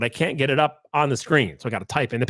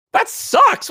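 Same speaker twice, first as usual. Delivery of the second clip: much higher, louder, outraged disbelief; incredulous repeat; 4 dB louder than usual. Retold or imitated. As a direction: throw the doctor's words back in disbelief. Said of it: "What is that, a medical opinion?".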